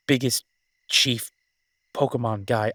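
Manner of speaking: playful announcer-style boast; mock boast. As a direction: puff it up like a proud champion. Joking boast of video game titles.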